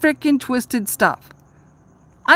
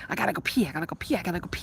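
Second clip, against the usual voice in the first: soft, fast repeated muttering; urgent mutter; 4 semitones lower, 11 dB quieter, much faster than usual. Retold or imitated. Waiting in the bar line, muttering fast and quiet. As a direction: rattle it off under your breath, desperate. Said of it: "and you're like".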